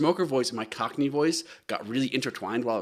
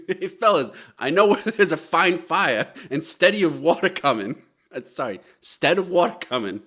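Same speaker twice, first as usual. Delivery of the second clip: a lightly voiced excited Boston child, growing louder and higher; excited Boston kid; 4 semitones higher, 6 dB louder than usual. Child at a fire telling reporters, excited Boston accent. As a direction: rattle it off like an eager Boston kid on the street. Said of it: "excited child who has a strong Boston accent".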